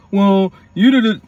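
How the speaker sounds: high, loud, slow mock accusation. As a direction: throw the blame back in a whiny voice. Mimicking family argument lines.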